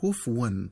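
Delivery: "كفوا" misread as "kufwan"; "كفوا" in two syllables, kuf-wan; wrong silent fa. Shown as a demonstration. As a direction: drop the vowel after f in "كفوا". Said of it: "ومن الخطأ قراءتها بتسكين الفاء".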